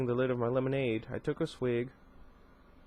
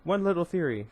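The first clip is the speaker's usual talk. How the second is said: lightly purred plea, pitched higher; purred coaxing; 6 semitones higher, 4 dB louder than usual. A narrator's reading. She wheedles for one theory, lightly purring. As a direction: coax with a higher, playful lilt. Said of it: "she purred".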